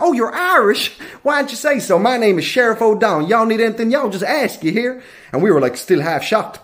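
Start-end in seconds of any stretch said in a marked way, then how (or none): 0.0-5.0 high-pitched Southern welcome; Louisiana sheriff. Acted. Sheriff learning the tourists are Irish, mock Southern welcome. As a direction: put on a suddenly friendly Southern sheriff. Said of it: "He was like".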